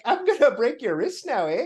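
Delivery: put-on sweetly threatening Minnesotan accent; cheerful Minnesotan interrogator accent. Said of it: "Like, imagine".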